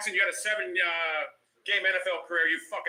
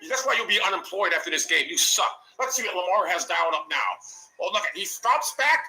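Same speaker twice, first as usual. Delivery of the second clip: ordinary talk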